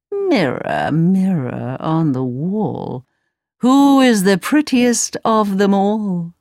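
slow, haughty queen calling on her mirror; a vain queen asking her magic mirror; lightly voiced wicked queen.

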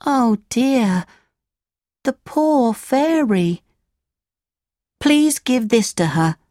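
put-on high, sorrowful boy voice; Pinocchio's voice. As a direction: pitch up, pity the poor fairy. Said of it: "said Pinocchio".

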